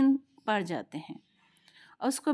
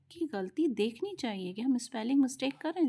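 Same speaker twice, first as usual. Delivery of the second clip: ordinary talk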